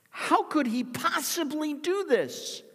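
very high, loud fervent cry; impassioned preaching. Acted. Preacher lamenting Peter's fall, high loud fervour. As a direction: build the outrage at full force.

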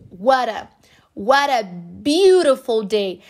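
"what a" as "wara", slowly; flapped T. Shown as a demonstration. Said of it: "what a fica what a". ("what a beautiful" com T soando como R)

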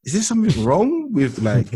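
very high, hammed-up bafflement; incredulous. Student wondering why nobody understands her, incredulous. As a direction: question it in a squeaky-high mock disbelief. Retold disbelief at being misunderstood.

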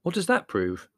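high, puzzled question; mildly baffled; bewildered. A narrator's reading.

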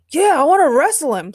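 high, loud wrestler's call; eager demand. A narrator's reading. The Rock asks after Ray to wrestle, loud. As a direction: call out keenly for the match.

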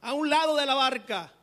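high, loud, slow call across the water; shouting from the beach. Acted. Man on shore calls out to the fishermen, loud slow shout. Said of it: "les grita desde la playa".

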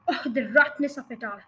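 plain reading voice

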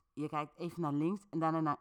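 ordinary delivery, nothing marked

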